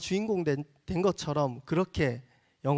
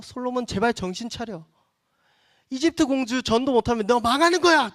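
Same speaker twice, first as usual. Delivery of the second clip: 안타까운 호소; 7 semitones higher, 5 dB louder than usual. Retold or imitated. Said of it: "마음 아파하고 안타까워하며".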